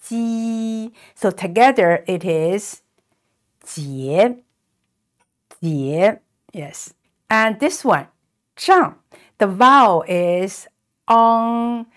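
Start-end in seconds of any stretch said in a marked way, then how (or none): 0.1-0.9 ji said softly and apart; soft ji for the letter J; ji sound. Shown as a demonstration. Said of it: "the letter J is pronounced". 3.7-6.2 jie rising, said twice. Shown as a demonstration. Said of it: "with a rising tone". 8.6-8.9 zhang starting high and dropping; falling tone zhang. Shown as a demonstration. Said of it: "here is a falling tone".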